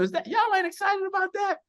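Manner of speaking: high-pitched, excited disbelief; incredulous rallying cry. Real, not acted. Host hyping her numbers to the call, incredulous and excited. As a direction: pitch leaps way up to rouse the crowd.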